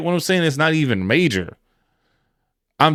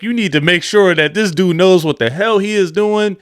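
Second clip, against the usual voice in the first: ordinary talk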